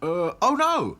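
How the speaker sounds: high, loud, flustered doll; hesitate, then cry out in alarm; quick alarm. A narrator's reading. Back to Front pretending the wizard is near, alarmed. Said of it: "said Back to Front quickly".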